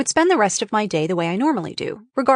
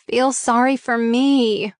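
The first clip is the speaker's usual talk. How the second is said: sobbing plea; 4 semitones higher, slower than usual. Retold or imitated. Wife begging husband for sympathy, slow, with a touch of a sob. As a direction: drag out a mild mock-sobbing plea. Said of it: "I projected with my sobs".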